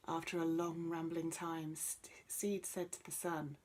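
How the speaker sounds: usual speaking voice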